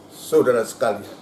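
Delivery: ordinary talk, nothing marked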